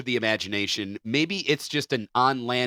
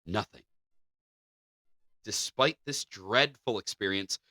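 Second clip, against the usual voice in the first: ordinary talk, nothing marked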